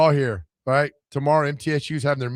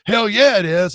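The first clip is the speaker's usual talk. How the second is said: high, loud angry outburst from the coach; mad coach; 7 semitones higher, 7 dB louder than usual. Retold or imitated. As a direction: bark it like a furious coach. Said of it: "Oh, he was mad".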